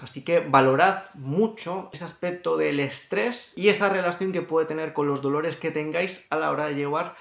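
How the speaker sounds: ordinary talk, nothing marked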